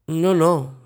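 hastening to answer. A narrator's reading. Hostess hastening to correct her guest about the balls. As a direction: jump in to deny it. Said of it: "si affrettò a dire".

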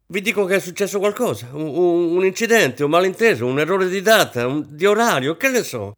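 loud, high, frantic outburst; losing control. A narrator's reading. Hostess insisting something went wrong, losing control. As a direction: pour it out in rising panic. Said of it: "perse il controllo".

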